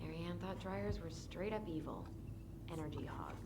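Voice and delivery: whispering. Acted. Muttered jab about dead aunt.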